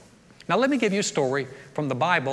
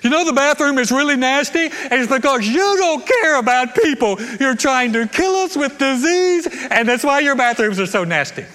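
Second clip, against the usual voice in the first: much higher and louder hammed-up tirade; mock furious rant; 8 semitones higher, 8 dB louder than usual. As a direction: ham up a blaming rant at a gas station clerk. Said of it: "Here's what I don't do".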